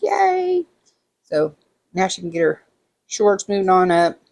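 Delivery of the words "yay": much higher little yay; mock squeal of joy. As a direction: squeak it out like a kid. Mock cheer at friend's news.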